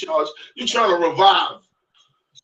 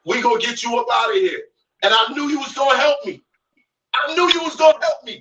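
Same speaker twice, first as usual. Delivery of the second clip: fast rising taunt; 6 semitones higher, faster than usual. Real, not acted. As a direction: rattle it off mocking him. Taunting his rival.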